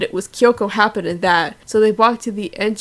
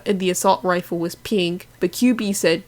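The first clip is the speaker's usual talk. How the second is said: plain reading voice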